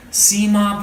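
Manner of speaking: telling her mother. A narrator's reading. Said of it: "Putul told her mother".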